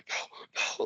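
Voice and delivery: imitating breathless, voiceless panic; freaked out. Brother staring back when accused, mock freaked-out gasps. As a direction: gasp it out as a freaked-out man. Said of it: "freaked out".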